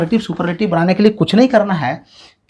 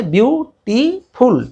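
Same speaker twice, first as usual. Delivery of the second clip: slow syllable tapping; 6 semitones higher, much slower than usual. Shown as a demonstration. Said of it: "तीन बार touch कर रहा है ना".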